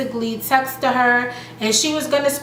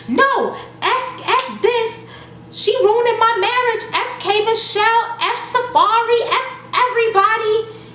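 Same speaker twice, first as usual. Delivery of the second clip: pitched-up imitation of an angry wife; mock angry rant; 12 semitones higher than usual. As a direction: rattle off everyone she's cursing. Lyrica cursing everyone out.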